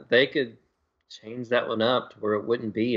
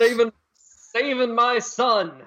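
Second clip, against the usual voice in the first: mock heroic dad; 5 semitones higher, slower than usual.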